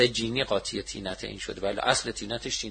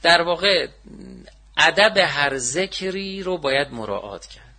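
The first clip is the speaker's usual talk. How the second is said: ordinary talk, nothing marked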